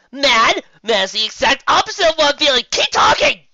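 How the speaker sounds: very high, loud excited outburst; loud high thrilled gushing; overjoyed fan. Real, not acted. Excited fan demands more.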